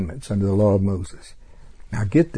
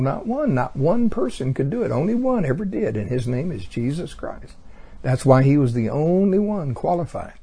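ordinary delivery

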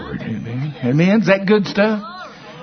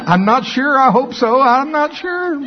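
laughing reply; 12 semitones higher, 3 dB louder than usual. Retold or imitated. Retelling his laughing reply to Bronk.

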